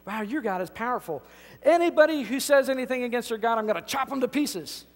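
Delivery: mock tyrant's vow, loud and high; tyrant king. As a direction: play the king swearing to chop them. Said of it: "he says".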